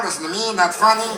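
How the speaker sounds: mock giddy helium voice; lightly squeezed party-trick squeak; party guests on helium. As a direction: imitate kids larking about on helium. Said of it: "Everybody's going".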